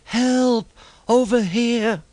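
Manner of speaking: high, loud distant cries for help; cry for help. A narrator's reading. Thomas buried in snow calling for rescue, high distant cries. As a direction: shout for help from far under the snow.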